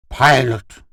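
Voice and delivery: high, loud plea; sad appeal. A narrator's reading. Gur appeals to his pilot, sad. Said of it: "Gur said sadly".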